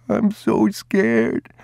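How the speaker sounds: put-on very high, slow, crying boy's voice; a terrified little boy near tears; crying boy clinging to his father; tearful child. A narrator's reading.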